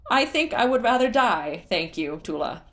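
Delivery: high, loud shout from above. Acted. Groove shouts his refusal down from the tower, loud. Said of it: "Groove shouted".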